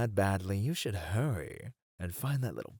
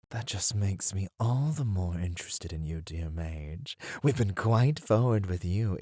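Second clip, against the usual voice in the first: ordinary talk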